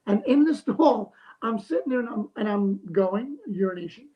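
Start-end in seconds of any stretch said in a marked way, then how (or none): none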